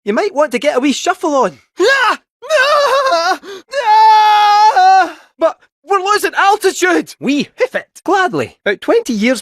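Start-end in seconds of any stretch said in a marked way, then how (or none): 1.8-5.3 very high, drawn-out screams; long wailing shriek of alarm; panicked scream. Acted. Screaming as they fall from the air. 5.4-7.2 high, urgent cry; high-pitched panicked warning; panicked alarm. Acted. Alarm as the craft goes down.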